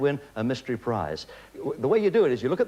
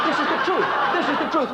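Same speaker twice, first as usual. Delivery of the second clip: laughing; 10 semitones higher, 3 dB louder than usual. Real, not acted. Host insisting, laughing.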